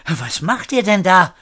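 high-pitched startled call. A narrator's reading. Woman rushing into Erik's room, calling out. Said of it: "rief die Frau".

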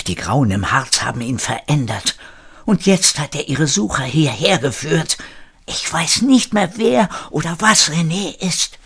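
building into an agitated scolding; schimpfend. A narrator's reading. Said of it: "schimpfte sich in Rage". (sich in Rage schimpfen)

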